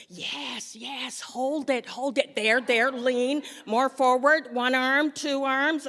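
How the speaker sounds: mock photo-shoot nurse, building to loud; bossy nurse photographer. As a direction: call out the poses like a pushy photographer. Nurse taking photos directs her.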